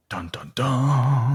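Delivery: slow whispered suspense sting; dramatic whisper. Pretend. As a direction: whisper it like a movie cue. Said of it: "That's usually my line".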